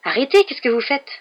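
usual speaking voice

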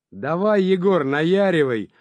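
high, loud rowdy yell with drawn-out words; bawling. A narrator's reading. Сивый eggs on dancing Егор, bawling. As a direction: holler to egg the dancer on. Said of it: "орал Сивый".

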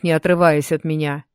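usual speaking voice

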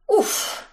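high breathy puff of relief; relieved puff. A narrator's reading. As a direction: blow out a relieved huff. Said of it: "пропыхтели".

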